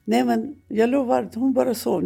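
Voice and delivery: pleading husband. Retold or imitated. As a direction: mimic him swearing she is only asleep. Husband placates the frightened children.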